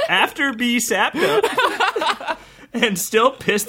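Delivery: slow, laughing through it; laughing reread. Shown as a demonstration. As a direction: read it again, cracking up. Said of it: "Read it again".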